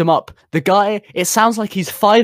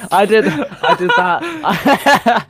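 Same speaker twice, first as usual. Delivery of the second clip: laughing; 5 semitones higher, much slower than usual. Real, not acted.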